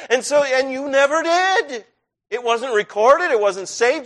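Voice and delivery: ordinary talk, nothing marked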